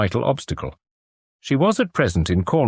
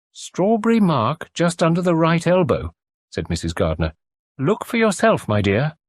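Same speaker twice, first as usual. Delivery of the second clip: higher, homely motherly voice; cosy country landlady; kindly farm wife; 8 semitones higher than usual. Acted. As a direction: fuss over the patient kindly. Landlady character voice.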